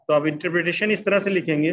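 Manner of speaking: normal talking voice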